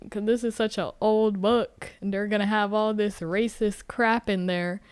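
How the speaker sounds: ordinary talk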